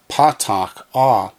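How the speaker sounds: ordinary talk, nothing marked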